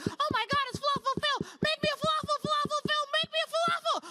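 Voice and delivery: put-on very high-pitched squealing little kid; excited five-year-olds. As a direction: imitate a small child clamoring for a star. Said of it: "ten snot-nosed five-year-olds".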